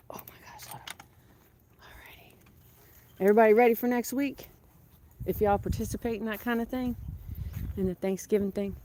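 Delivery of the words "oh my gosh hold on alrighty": breathy whispered alrighty. Real, not acted. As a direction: whisper it so the animals don't startle. Quiet moment by the geese.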